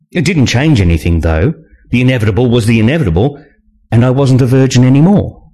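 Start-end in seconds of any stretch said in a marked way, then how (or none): none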